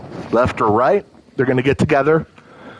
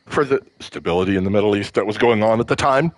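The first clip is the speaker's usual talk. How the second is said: lower, faster reporter's question; quoting the interviewer; 6 semitones lower, faster than usual. Retold or imitated. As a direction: rattle it off like the TV interviewer. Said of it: "And they said".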